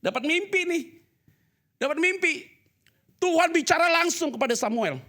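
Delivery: high, loud, slow calling of a name; God calling. Acted. Preacher acting God calling Samuel in a loud voice. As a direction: call out as God waking the boy.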